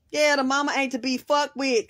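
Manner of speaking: usual speaking voice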